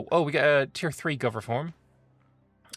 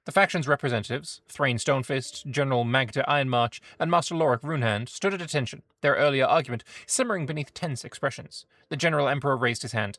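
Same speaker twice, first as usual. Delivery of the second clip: storyteller reading an event; story narration; 3 semitones higher, 5 dB louder than usual. A narrator's reading. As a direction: read it like a fantasy tale. Reads event story aloud.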